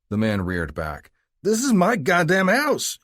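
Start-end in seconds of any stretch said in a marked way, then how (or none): 1.4-3.0 much higher, loud, slow outraged yell; furious cry. A narrator's reading. Drunk yelling at the cop on his porch, enraged. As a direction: bellow it at the cop. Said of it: "he cried".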